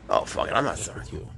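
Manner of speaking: usual speaking voice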